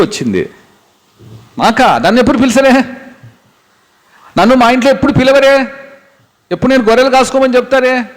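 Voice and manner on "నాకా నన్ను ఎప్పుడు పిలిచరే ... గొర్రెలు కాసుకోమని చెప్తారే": high, incredulous questions; can't believe anyone wants him; surprised disbelief. Acted. Young David asks why he is called, high and disbelieving.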